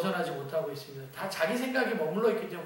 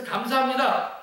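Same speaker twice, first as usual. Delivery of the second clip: higher, louder acted thanks; grateful; 6 semitones higher, 5 dB louder, slower than usual. Hired worker thanks the vineyard owner, gratefully. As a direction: lightly play a worker thrilled to be picked. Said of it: "감사한 마음으로 갔거든요".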